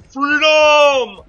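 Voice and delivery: long, high, full-throated yell; yelled battle cry. Acted. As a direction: bellow it like charging into battle, drawn out.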